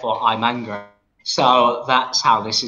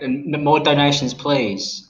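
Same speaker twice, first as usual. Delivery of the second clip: normal talking voice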